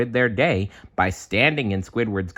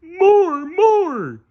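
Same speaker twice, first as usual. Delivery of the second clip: very high, loud squeaky demand; a tiny creature crying for more; little face demanding; 8 dB louder than usual. A narrator's reading. Face on Patrick's forehead demanding food, high loud squeak. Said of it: "demanded the little face".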